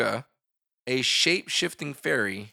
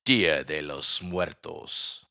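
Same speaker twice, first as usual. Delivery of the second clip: deep, drawn-out Spanish accent; slow, low Spanish showpiece; Spanish pronunciation; 8 semitones lower, much slower than usual. Shown as a demonstration. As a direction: intone it very slowly and low in Spanish. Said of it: "I'm gonna say it again".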